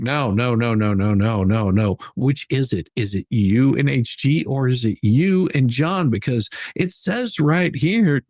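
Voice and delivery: ordinary talk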